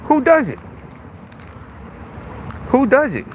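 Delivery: high, insistent rhetorical question; real high-pitched indignation; indignant challenge. Real, not acted. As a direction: throw the question out high in protest. Indignant rhetorical question, defending anger.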